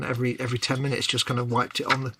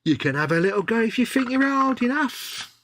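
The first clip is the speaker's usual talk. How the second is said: put-on higher, cheeky East End game-show host accent; East End Bruce Forsyth; 10 semitones higher than usual. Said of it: "I don't know what that accent was. It was like Bruce Forsyth".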